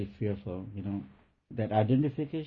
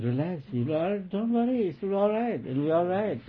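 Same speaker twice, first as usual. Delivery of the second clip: soothing granny; 9 semitones higher than usual. Retold or imitated. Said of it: "And she was saying".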